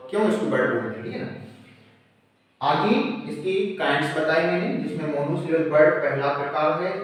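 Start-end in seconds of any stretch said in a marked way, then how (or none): none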